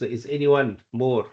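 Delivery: normal talking voice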